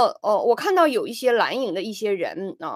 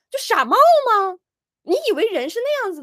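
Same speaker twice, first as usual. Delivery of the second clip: exasperated scorn; 10 semitones higher, 5 dB louder than usual. Real, not acted. Exasperated at foolish people.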